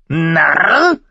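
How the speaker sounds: hoher, lauter Rabenschrei mit steigender Frage; Narr? hoch und schrill wie ein Rabe krächzen; krächzender Rabe. A narrator's reading. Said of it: "rief er erneut". (der Rabe äfft Mormont nach: Narr? hoch krächzend)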